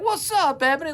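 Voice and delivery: high, bright hello; high and cheery, greeting a sick friend at her bedside. Retold or imitated. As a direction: greet her with big upbeat energy.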